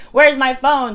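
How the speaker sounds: loud, high retold outburst; panicked demand.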